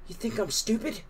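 high, slightly hushed indignant question; hissed retort. A narrator's reading. Susan to her brother Hank, high mild hiss. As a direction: throw it back high, kept down. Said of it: "Susan hissed".

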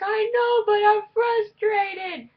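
high, loud, whining protest; whiny frustration. Retold or imitated. Answering mom on the phone, high whine. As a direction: complain like a pouting kid. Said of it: "I'm like".

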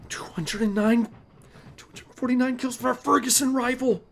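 high, incredulous exclamation; gasp, then cry the number out high; shocked disbelief. Real, not acted. Shocked at unlock requirement.

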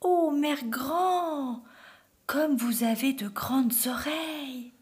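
high, very drawn-out wonder; amazed little girl. A narrator's reading. Girl marvelling at grandmother's ears, slow high amazement. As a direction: stretch the amazement out slowly.

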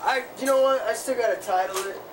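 normal talking voice

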